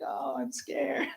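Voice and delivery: high, slow mock whimper; imitated crying child. Crying son afraid to climb again, imitated whimper. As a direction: imitate a scared kid crying, softly and drawn out. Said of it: "he's, like, crying".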